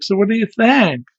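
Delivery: high chirpy question; bright sales pitch; clerk voice. Retold or imitated. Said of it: "the woman who works there is like".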